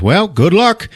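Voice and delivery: high, mock-cheery send-off; breezy sign-off. Intruder leaving the frightened woman, breezy. Intruder's abrupt farewell acted out.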